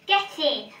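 ordinary talk, nothing marked

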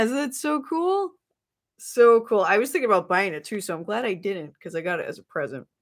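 ordinary talk

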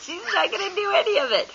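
real laughter running through quick words; laughing. Real, not acted. Parent laughing at uncooperative toddler.

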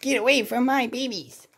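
high protective warning; cartoon mother voice. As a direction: chase them off, fierce but cartoony. Mother alligator warns raccoon.

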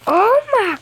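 high slow gasping oh my god; high drawn-out surprise; shocked. Real, not acted. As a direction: stretch it out amazed. Sudden gasp discovery.

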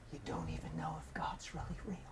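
very soft whisper, barely there; hushed divine whisper. Retold or imitated. God speaking quietly to a lost man at work, whispered. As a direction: whisper it like a voice slipping up on someone. Retelling God's voice heard at work.

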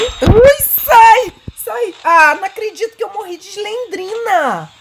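high panicked cry; panicked scream. Real, not acted. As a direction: shriek as she dies. Caught by Slendrina, dies.

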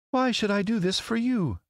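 very high lady's voice. A narrator's reading. Lovely lady answering a scarecrow's plea, regal. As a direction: regal question, pitched far up.

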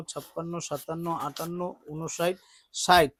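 soft, low steady counting; counting aloud. Real, not acted. Counting donated money for the family, soft and steady. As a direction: count the notes under your breath. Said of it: "counting the money".

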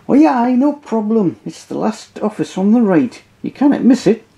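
high, bright-voiced porter; lightly voiced Scottish porter; cheery porter. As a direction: point the way helpfully. Porter answers in put-on voice.

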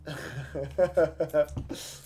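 long run of chuckles; rolling, easy laugh; amused chuckle. Real, not acted. Amused by a viewer's comment.

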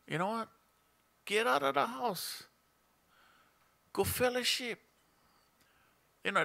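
ordinary talk

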